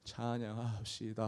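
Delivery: very soft, slow, low and weak; weary call to praise. Acted. Preacher voices Israelites too parched to praise, weary and faint. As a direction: let the call sag, drained and hushed. Flagging worshippers' weakened praise.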